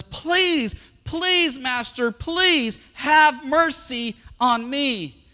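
high, drawn-out acted begging; desperate pleading. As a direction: plead slowly and dramatically for mercy. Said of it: "And the guy pleads further".

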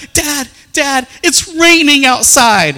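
put-on high, loud, slow gushing child; excited daughter. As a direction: gush like an eager little girl.

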